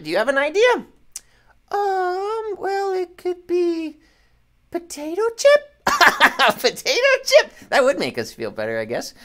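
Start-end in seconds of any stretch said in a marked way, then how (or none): 1.7-5.7 puppet voice. Pretend. Host voices puppet Peanut. 5.9-7.5 laughing. Real, not acted. Host repeats puppet's answer laughing.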